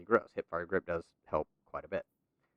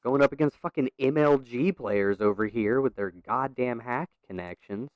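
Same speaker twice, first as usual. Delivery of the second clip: raging gamer; 5 semitones higher, 7 dB louder, slower than usual. Real, not acted. Ranting about opponents' connections.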